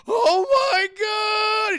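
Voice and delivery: high, loud, drawn-out shriek of alarm; freaking out. Retold or imitated. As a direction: stretch it out in horror. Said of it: "she starts freaking out".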